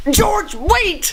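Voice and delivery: high, urgent call; calling after. Acted. Friend calling after George as he storms away, urgent. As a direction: shout after him as he leaves.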